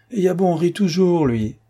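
captain cheering Coralie, high hearty lift; cheerful burst. Acted. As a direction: brighten the room with a laughing lift.

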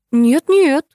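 quick high protest. A narrator's reading. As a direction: object eagerly. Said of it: "возразил издатель". (горячо возражая)

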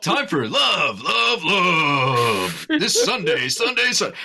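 shouted high, loud rally announcer; monster truck ad. Pretend. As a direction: yell it like a Sunday-Sunday-Sunday promo. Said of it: "I wanna do, like, a monster truck rally".

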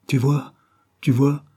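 crowing in triumph over the statue; victorious. Acted. Christine crows at Claude, victorious. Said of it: "répétait victorieusement Christine".

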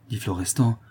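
plain reading voice

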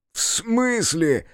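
high, drawn-out question; high-pitched, incredulous reaction; stunned disbelief. A narrator's reading. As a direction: stretch it out in astonishment. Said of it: "выпучил глаза Степ".

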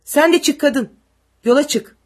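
high, loud soldier's command; loud and peremptory; barked order. A narrator's reading. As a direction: shout her out onto the road. Soldier orders woman onto road.

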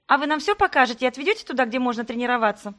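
put-on an eager girl firing questions; quick excited gabble; rapid eager question. Eager twin peppering questions.

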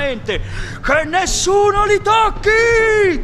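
high, loud, slow order stopping the fight; thundering command. Acted. Sandokan halting his men, thundering command. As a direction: thunder it over the whole deck. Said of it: "tuonò la voce della tigre".

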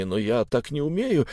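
usual speaking voice

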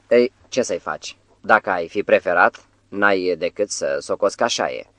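ordinary delivery

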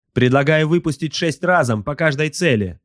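high-pitched crew slave barking out; barked suggestion. A narrator's reading. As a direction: bark it up to the master in a high voice. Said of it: "гаркнул раб войны".

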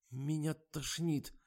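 high, slow queasy moan; nauseated whine. A narrator's reading. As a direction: groan as if about to be sick. Nauseous actress.

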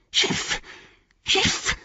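unvoiced creature hiss. A narrator's reading. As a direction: hiss it like a snake. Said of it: "fauchte er".

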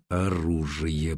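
slow, low, soft mumble; muttering. A narrator's reading. Oleg muttering to himself, low and slow. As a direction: murmur it slowly to yourself. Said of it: "пробормотал Олег".

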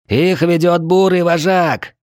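high, slow, carrying call; lookout's shout. A narrator's reading. Lookout on the wall reports the attackers, high carrying shout.

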